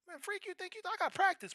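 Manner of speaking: very high, exasperated mock snap; irritated brush-off. Brushing off girlfriend before practice, exasperated. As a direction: swat her away, annoyed.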